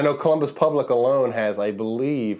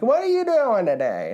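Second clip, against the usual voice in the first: mimicking old church ladies; 10 semitones higher than usual. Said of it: "they're like".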